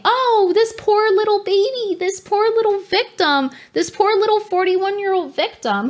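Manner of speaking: high, cooing pity for a poor little victim; mock pity voice. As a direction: coo the pity, then let the sarcasm show. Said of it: "to manipulate you into believing".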